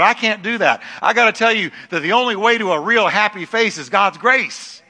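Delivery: loud, high preaching cry. Acted. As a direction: preach it out with full force. Preaching at full force.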